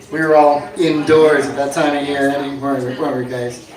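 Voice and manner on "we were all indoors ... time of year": ordinary talk, nothing marked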